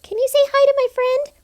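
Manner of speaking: high, squeaky mock voice; squeaky request. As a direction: put on a high cutesy voice asking. Friend films greeting request.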